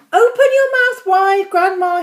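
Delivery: high, loud and eager; eager command. A narrator's reading. George about to spoon medicine into Grandma, eager command.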